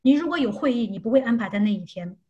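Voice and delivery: ordinary delivery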